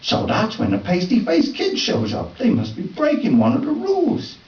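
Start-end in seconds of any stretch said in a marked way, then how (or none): none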